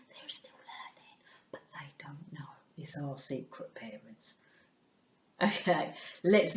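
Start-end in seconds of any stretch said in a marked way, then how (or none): 0.1-4.4 hush, let the parents in on it; conspiratorial whisper. Acted. Teacher whispering a secret to the parents.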